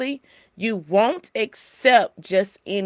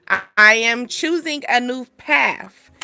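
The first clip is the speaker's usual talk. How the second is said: ordinary talk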